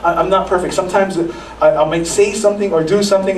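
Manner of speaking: usual speaking voice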